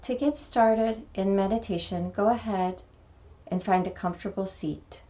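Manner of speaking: calm meditation guide, long pauses between phrases; guided meditation. Acted. Teacher guiding beginners through a mini meditation, calm meditation voice. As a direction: lead gently into stillness, unhurried, letting each phrase settle.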